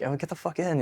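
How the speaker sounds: casual offer. Retold or imitated. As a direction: imitate a friendly gruff local waving him in. Marine offers a ride.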